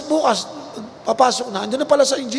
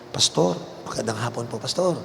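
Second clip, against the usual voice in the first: softer polite greeting; 5 semitones lower, 6 dB quieter than usual. Retold or imitated. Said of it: "Hindi ako pinapansin".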